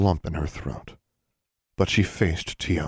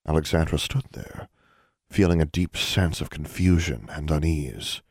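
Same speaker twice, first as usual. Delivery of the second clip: usual speaking voice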